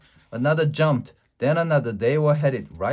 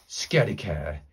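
ordinary delivery, nothing marked

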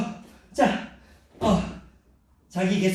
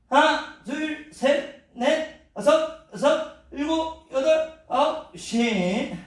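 shouted count while exercising; 9 semitones higher, much slower than usual. Acted. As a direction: count it out mid-workout.

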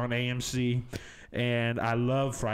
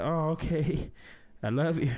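sweet reply; 5 semitones higher than usual. Retold or imitated. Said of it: "No, I said".

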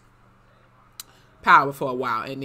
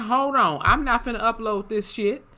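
raised, exasperated quote of himself; indignant retold rant, pitched up; mock outrage; 7 semitones higher than usual. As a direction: act out refusing to post bad footage. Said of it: "And I'm like".

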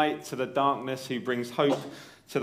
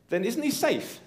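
put-on much higher, worried child's question; anxious Lucy; 10 semitones higher than usual. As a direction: ask like a frightened little girl. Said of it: "said Lucy".

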